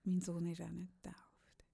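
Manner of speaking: mock-confiding whisper. Real, not acted. Confessing to the co-host about her son, whispered. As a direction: drop to a secretive whisper.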